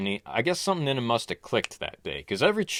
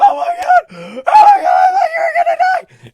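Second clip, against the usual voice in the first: shrieking, sobbing-laughing very high mother; overjoyed mother wailing at your bedside; hysterical mom; 14 dB louder than usual. Retold or imitated. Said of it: "my mom was like".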